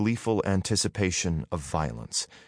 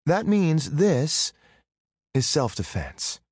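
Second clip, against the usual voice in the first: slow smug declaration; 8 semitones higher, slower than usual. A narrator's reading. Girl pulls daggers.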